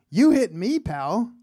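high, loud jab on the opening words; indignant retort. Retold or imitated. Crash victim answering the driver who hit him, indignant. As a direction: throw it back at him, indignant.

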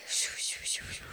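soft whisper of crunching steps; whispered snow sounds. Retold or imitated. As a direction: breathe out the shoo like boots in fresh snow. Imitating footsteps in snow.

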